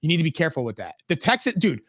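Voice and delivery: normal talking voice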